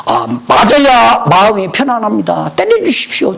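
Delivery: high, loud begging as the students; insistent pleading. Retold or imitated. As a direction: plead earnestly to be struck.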